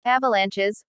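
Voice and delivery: ordinary delivery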